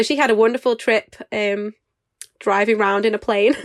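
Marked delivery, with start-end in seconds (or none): none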